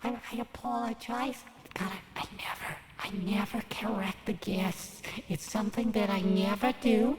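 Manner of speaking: hushed, fast whispered apology; whispering. Acted. Scolded assistant apologizes under breath.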